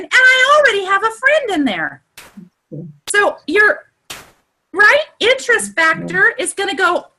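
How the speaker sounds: high, excited coaching voice. Real, not acted. Coach thrilled about joining groups with mutual friends, high and excited. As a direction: let the excitement push your pitch way up. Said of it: "So I'm so excited".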